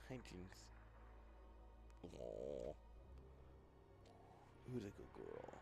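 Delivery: squeaky praising a dog. Real, not acted. Talking to his dog.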